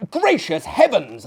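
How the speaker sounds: startled exclamation. Acted. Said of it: "I ejaculated".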